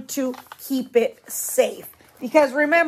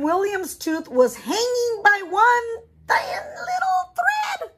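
narration climbing much higher to a near shout on thread; rising suspense; 8 semitones higher than usual. A narrator's reading. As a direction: build the tension to a cry. Suspense peak in story.

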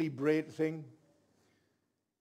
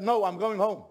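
loud, higher, irritated retort; annoyed refusal; 4 semitones higher, 7 dB louder than usual. Retold or imitated. Preacher refusing to pray for people, annoyed. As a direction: act out a fed-up refusal. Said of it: "I was so annoyed".

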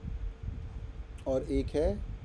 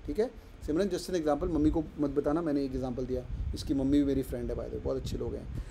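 usual speaking voice

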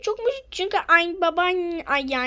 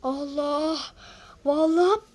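ordinary delivery

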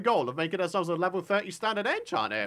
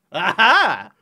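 triumphant aha; 11 dB louder than usual. Real, not acted. Laughing at his roof.

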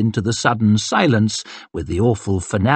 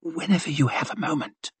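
soft, higher lady's whisper; hushed flirtatious whisper; 6 semitones higher, 8 dB quieter than usual. A narrator's reading. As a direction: breathe it to him, very quietly. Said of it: "she whispered".

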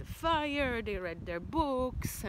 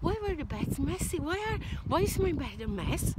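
lightly voiced daddy bear, higher and louder; a hint of an outraged father bear; mock-indignant bear; 8 semitones higher, 6 dB louder than usual. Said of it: "goes Daddy Bear".

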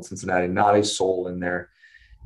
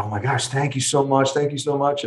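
higher-pitched, effusive imitation of thanks; retold grateful relief; 5 semitones higher than usual. Relieved parents thanking the surgeon, imitated grateful gush.

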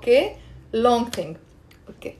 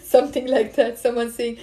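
ordinary delivery

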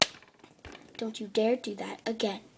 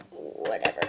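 dismissive; 5 dB quieter than usual. Acted. Bored dismissal.

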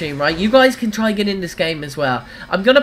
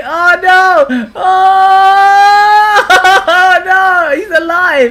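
high, drawn-out wail breaking into laughter; loud mock dismay; 9 semitones higher, 6 dB louder, much slower than usual. Real, not acted. Hits someone on friends list.

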